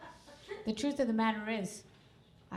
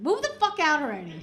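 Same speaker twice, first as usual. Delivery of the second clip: sudden high, loud outburst; exasperated yell; 8 semitones higher, 11 dB louder than usual. Retold or imitated. Mother teaching daughter suddenly snaps, exasperated yell. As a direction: burst out shouting at your kid. Birds talk flips into eviction.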